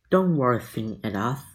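ordinary delivery, nothing marked

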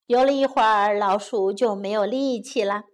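plain reading voice

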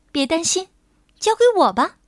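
high, bright reassuring fairy; a confident little fairy taking charge; tiny tinkling fairy voice. Acted. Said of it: "花瓣仙子说".

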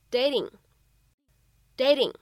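"dating" with the t flapped like a quick d, "dading". Shown as a demonstration. Teacher demonstrating American flap T in "dating". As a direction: tap the t in "dating", American style, slowly. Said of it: "美式发音当中呢所独有的flap T".

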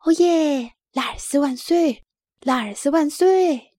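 high, loud, jubilant cheer. A narrator's reading. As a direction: shout hurrah for the hero. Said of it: "欢呼起来". (兴高采烈地高声欢呼)

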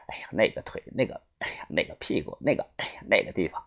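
low, soft, savouring leer; half-whispered, relishing every word; leering murmur. Acted. Villain gloats to the old father, in a leering murmur.

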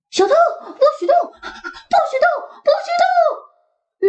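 叫着. Acted. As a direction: yap it out chasing. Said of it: "Max叫着". (大声叫喊)

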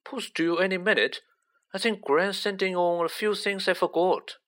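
high, lightly acted boy's voice; cheerful Neville. A narrator's reading.

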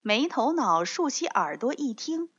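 usual speaking voice

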